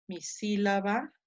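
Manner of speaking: normal talking voice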